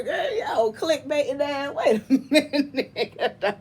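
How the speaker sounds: loud laughing jab; laughing roast. Real, not acted. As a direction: crack up while teasing. Laughing while roasting Dr Phil.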